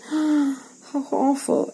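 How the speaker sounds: gasp, then slow and high; horrified gasp. Acted. Teacher voicing the brother's shock, gasping slowly. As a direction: act shocked, drawn out slowly.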